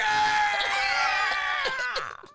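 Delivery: high shrieking raptor call; dinosaur screech. Pretend. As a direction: screech like a T-Rex. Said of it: "That was a good noise".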